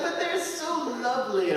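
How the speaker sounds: high, doting mock gush over polar bears; mock doting friend. Retelling Jane's love of polar bears, mock doting gush. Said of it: "She's like".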